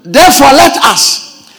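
preaching at full force. Acted. Pastor proclaims the verse to the church, loud preaching. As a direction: thunder the scripture to the congregation.

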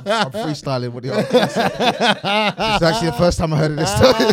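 loud laughing speech; laughing while talking. Real, not acted. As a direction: talk through loud laughter.

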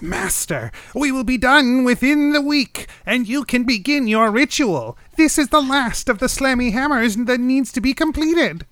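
scurrying minion proud of its work; eager goblin. Acted.

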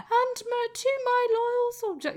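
very high, hammed-up posh British broadcast voice; posh British accent. Royal broadcast to his loyal soldier, mock posh British accent. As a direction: imitate an old posh British radio address. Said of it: "they're very posh".

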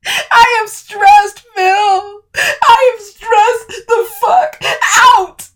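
slow, high, crying shout; crying outburst. Acted. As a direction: sob it out loudly. Camille breaking down, crying.

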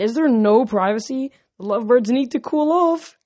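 loud, high teasing glee; gleeful. A narrator's reading. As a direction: tease them with glee. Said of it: "Clarice said with glee".

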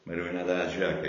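normal talking voice

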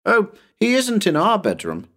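put-on high-pitched boy's protest; protesting child. Tommy corrects Bill, high protesting boy voice. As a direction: object high, like a small boy. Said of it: "protested Tommy".

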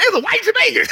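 put-on giddy childlike shout, laughing; excited kid. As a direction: point and squeal like a little kid. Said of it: "I'm pointing at him like".

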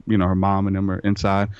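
ordinary delivery

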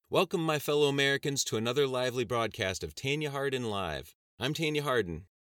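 brassy talk show host opening; TV host proclaiming. Acted.

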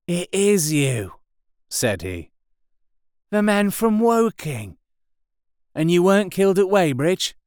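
artilleryman's sudden recognition; surprised recognition. A narrator's reading. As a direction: realise who he is.

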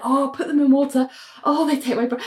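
high put-on dreamer's voice; lightly hammed-up rapture; Bria, dreamy sleep-talk. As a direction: imitate someone swooning in her sleep. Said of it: "completely asleep having this conversation".

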